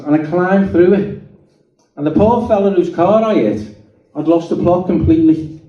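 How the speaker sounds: normal talking voice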